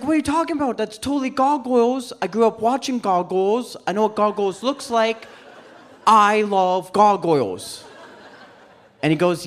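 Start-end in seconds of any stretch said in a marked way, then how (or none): 0.0-7.8 high-pitched protest, slowing on the final declaration; indignant insistence. Acted. Comic argues with roommate over cartoon, indignant insistence. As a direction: protest like a wounded superfan.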